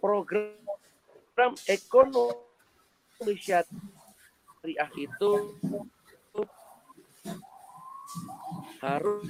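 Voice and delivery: glitchy jumping pitch, dropouts; choppy breaking-up audio. Real, not acted. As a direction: voice cutting in and out over a bad connection. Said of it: "Putus-putus ya?".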